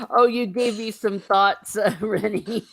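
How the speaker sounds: loud laughter breaking through the words; talking through loud real laughter; laughing. Real, not acted. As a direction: laugh your way through the line. Laughing at the joke.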